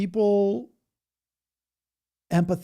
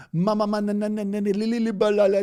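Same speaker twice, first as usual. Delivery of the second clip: higher, louder gibberish chant; mock speaking in tongues; 7 semitones higher, 5 dB louder, faster than usual. Mocking churchgoers speaking in tongues, higher and louder. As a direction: ham up the tongues babble like a worshipper. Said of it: "just saying weird stuff".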